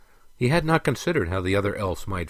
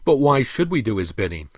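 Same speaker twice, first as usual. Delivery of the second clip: higher, louder challenge; retorting; 4 semitones higher, 6 dB louder than usual. A narrator's reading. Prince challenges the king about obeying the Emperor, retorting. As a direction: throw the question back hotly. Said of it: "retorted the prince".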